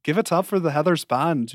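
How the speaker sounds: practiced Irish accent. Shown as a demonstration. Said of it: "phrase to get into an Irish accent".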